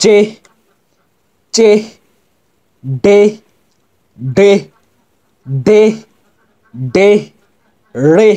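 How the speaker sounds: জে, চে, ডে, রে recited singly, each pushed out with a final h; h-ending drill. Shown as a demonstration. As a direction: punch each of জে, চে, ডে, রে with a puff of air. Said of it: "এরকম উচ্চারণ হবে". (জে, চে, ডে, রে-র শেষে জোরে হাওয়া)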